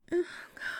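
ordinary talk

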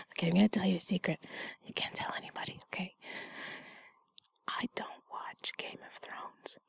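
hushed secret whisper. Acted. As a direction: whisper it like a confession.

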